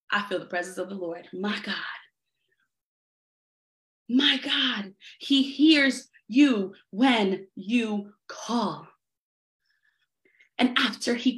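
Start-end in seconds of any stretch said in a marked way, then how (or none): 0.1-1.7 hushed, fast, near whisper; awed hush. Real, not acted. Preacher overcome, sensing God's presence, whispers in awe. 4.1-8.9 tender reassurance. Real, not acted. Preacher softly reassures congregation.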